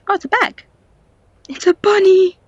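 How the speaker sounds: loud, high excited cry; delighted squeal. Real, not acted. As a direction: squeal at the cute find. Delighted discovery of a bunny.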